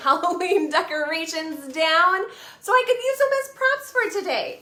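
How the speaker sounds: ordinary delivery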